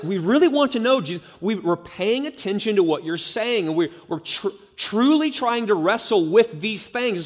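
high, eager insistence; earnest questioner. Acted. Crowd member assuring Jesus they really want to know, earnest.

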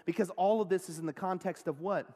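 usual speaking voice

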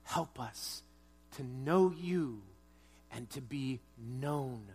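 soft, slow closing prayer. Real, not acted. Pastor praying over the congregation, soft and slow. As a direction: pray gently and unhurried.

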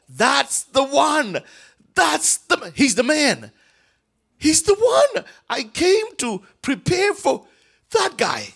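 high, loud, slow proclamation; excited proclaiming. Retold or imitated. John telling the crowd who Jesus is, excited and high. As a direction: announce him with rising joy. Preacher acting John's excitement.

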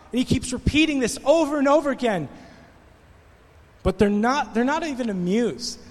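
ordinary talk

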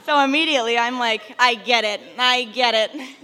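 loud laughing concession. Real, not acted. [name] laughs admitting God told her to stop talking. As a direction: laugh it out, caught out.

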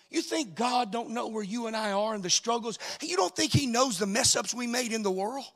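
sung, rising preacher's chant over music; preacher chanting to the congregation over the organ, climbing; whooped preaching. Acted.

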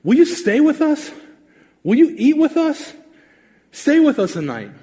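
high, eager invitation to stay; eager pleading. Retold or imitated. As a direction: beg him to stay, voice lifted high. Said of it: "they say".

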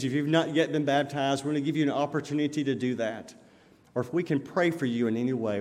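usual speaking voice

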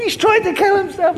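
high, loud re-enacted scream; acted panicked yell; screaming in alarm. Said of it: "I'm screaming".

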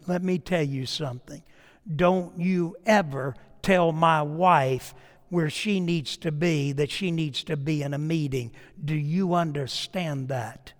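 retold quiet warning. Pastor warning a deacon about his wife, quiet low warning.

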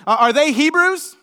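loud, high, daring question; mock rival's proud question; boastful challenge. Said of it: "He says".

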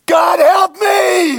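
high, slow, loud desperate wail; crying out to God. Shown as a demonstration. Preacher showing the congregation how to plead with God. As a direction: cry out broken for help, drawn out. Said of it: "we do it like this".

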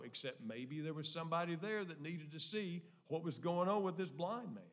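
ordinary talk, nothing marked

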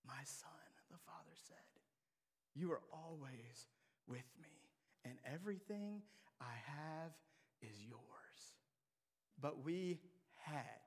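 quiet fatherly comfort; tender father. Acted. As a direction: gently soothe the angry son. Father's gentle reply.

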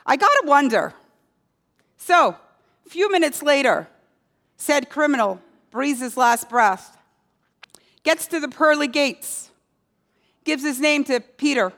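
ordinary delivery

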